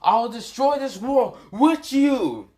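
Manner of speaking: high, loud, stretched-out villain cry; villain's death threat. Acted. Villain hurling a supernova at his foe, high raging cry. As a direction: build to a high world-ending shout. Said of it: "Now, he says".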